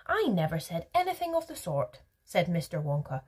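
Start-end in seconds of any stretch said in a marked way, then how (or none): 0.1-2.0 high-pitched protest; indignant denial. A narrator's reading. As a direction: deny it with a falling finish. Wonka denies it in a quarrel.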